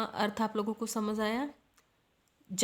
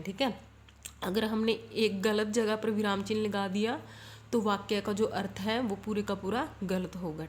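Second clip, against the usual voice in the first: ordinary delivery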